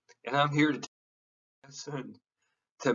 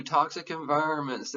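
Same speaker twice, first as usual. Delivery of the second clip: ordinary delivery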